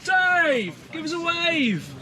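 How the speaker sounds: loud, high call down. Real, not acted. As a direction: shout down to him. Calling out to someone below.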